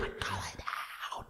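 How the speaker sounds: slow whispered order from his partner; a drawn-out hushed command; slow, soft whisper to the dog. Retold or imitated. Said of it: "And Kai's just like".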